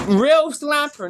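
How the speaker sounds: "Real" punched loud, high and slow; stress on REAL. Retold or imitated. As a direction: hit the word "Real" hard and slow. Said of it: "not fake".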